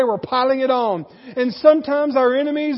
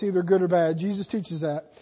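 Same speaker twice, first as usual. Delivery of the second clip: usual speaking voice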